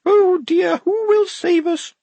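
high, slow trembling plea; quavering. A narrator's reading. Blouse to his squad, a high quavering cry for rescue. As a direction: quaver it out like a frightened officer. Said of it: "Blouse quavered".

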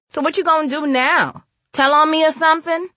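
put-on high-pitched, challenging teen voice; sassy girl. A narrator's reading. Girl asks if woman will tell on her, sassy. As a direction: needle her with high, lifted questions.

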